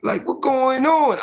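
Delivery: put-on scared boy. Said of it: "I'm like".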